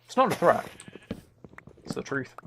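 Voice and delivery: ordinary talk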